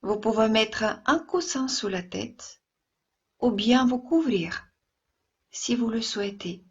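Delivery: ordinary talk, nothing marked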